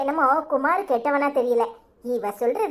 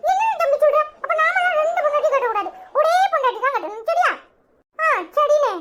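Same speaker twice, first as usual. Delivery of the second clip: much higher, squeaky comic bystanders bantering; cartoon gossip voices; 11 semitones higher than usual. Two onlookers mocking the couple's quarrel, in a much higher squeaky cartoon voice.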